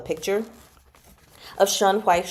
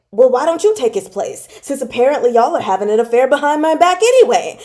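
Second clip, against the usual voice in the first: mock accusing prince; 4 semitones higher, 6 dB louder, faster than usual. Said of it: "the prince was like".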